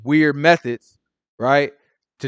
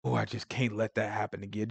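hushed, low, troubled pleading; stressed-out confession; 4 semitones lower, 13 dB quieter than usual. Pretend. Churchgoer confessing mistakes to Christians, stressed-out and hushed. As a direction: confess softly, as if weighed down by the slip. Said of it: "Just go in there real stressed out about it".

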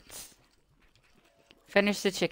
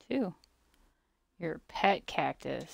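ordinary delivery

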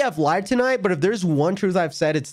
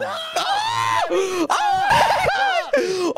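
excited squeal. Real, not acted. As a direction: squeal with shocked delight. Hearing the soundboard clip.

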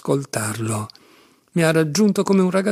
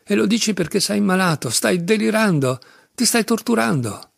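high, afflicted insistence; distressed exclamation; 6 semitones higher than usual. A narrator's reading. Alyosha telling his sick brother he's raving, distressed. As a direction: plead in distress, pitched up. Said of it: "esclamò Alyosha afflitto".

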